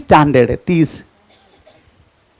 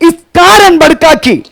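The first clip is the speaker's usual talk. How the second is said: preaching repeat; 7 semitones higher than usual. Acted. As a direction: echo the verse with force. Preacher repeats reading loudly. (ऊँची आवाज़ में प्रचारक की तरह दोहराना)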